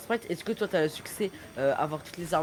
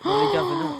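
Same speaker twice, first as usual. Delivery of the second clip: loud call; 13 semitones higher, 7 dB louder than usual. Real, not acted. Excited calling.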